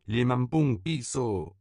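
put-on second kid bawling for money. A narrator's reading. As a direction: bawl it up at the window. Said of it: "brailla le second".

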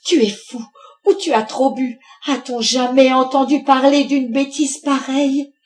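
high, loud, scolding tirade; exploding in anger. A narrator's reading. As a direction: berate your husband with outraged questions. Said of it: "la mère Louveau éclata".

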